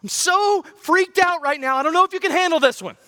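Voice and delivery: high, rushed, hammed-up worry; mock panicked prayer. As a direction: play the frantic pray-er for laughs. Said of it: "some of our prayers are kinda like this".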